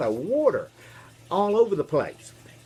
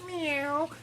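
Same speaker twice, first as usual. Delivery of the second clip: high-pitched imitation meow; high, drawn-out kitty mew; cat meow; 12 semitones higher, 5 dB quieter than usual. Shown as a demonstration. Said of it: "What do you think it sounded like".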